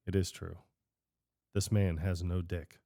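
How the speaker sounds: low, soft deadpan. Retold or imitated. Pete answering the mayor, low soft deadpan. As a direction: drop it low and dry.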